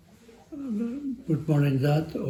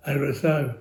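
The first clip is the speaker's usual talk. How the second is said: usual speaking voice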